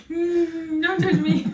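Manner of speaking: high whimpering mock voice of the boyfriend; hurt boyfriend. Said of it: "he's like".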